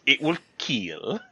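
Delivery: slow, drawn-out deadpan verdict; imitating the sword tester. Mocking the TV expert's verdict, slow deadpan. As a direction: drag out kill like keel. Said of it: "I don't know why he says it like that".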